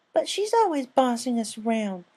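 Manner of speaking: high whiny filly complaint; complaining filly. Acted. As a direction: grumble in a high kid voice. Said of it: "the young yellow filly complained".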